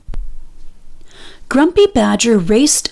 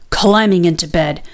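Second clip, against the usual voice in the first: ordinary delivery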